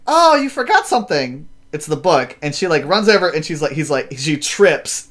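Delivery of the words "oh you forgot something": high chirpy mock girl voice; lightly voiced cheerful girl. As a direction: call it out brightly, much higher.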